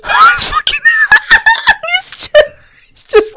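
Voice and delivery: cracking up, words squeaking out high; high, breaking-up laughter; helpless laughter. Real, not acted. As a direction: try to talk through a real laughing fit. Cracks up at the bear.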